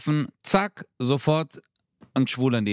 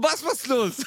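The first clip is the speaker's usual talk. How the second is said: incredulous mock retort; 11 semitones higher than usual. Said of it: "Ich so".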